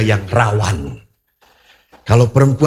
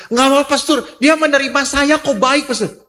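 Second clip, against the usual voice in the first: higher, eager, insistent protest; pleading young woman; 13 semitones higher than usual. Retold or imitated. Woman begging the pastor not to judge her fiance, pleading. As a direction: keep defending him, pleading high.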